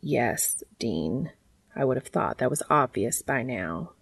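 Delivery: low, soft, patient character voice; infinitely patient. Acted. Cass patiently answers Dean about something obvious, low and soft. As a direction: drop low and quiet, patiently stating the obvious. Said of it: "his infinitely patient, heavenly being look".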